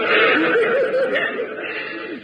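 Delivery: breaks into a high laugh; giggling fit mid-line; high burst of laughter. Real, not acted.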